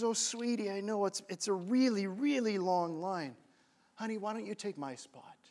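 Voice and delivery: soft, close, comforting voice; hushed, caring offer; tender kind woman. Retold or imitated. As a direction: speak gently, as if leaning down to someone. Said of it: "the woman at the front leans closer".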